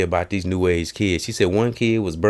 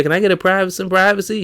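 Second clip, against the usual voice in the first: high put-on woman voice; mock woman retort; 13 semitones higher, 4 dB louder, faster than usual. Breastfeeding woman answering the man, high mock voice. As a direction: snap back pitched high. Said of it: "She said".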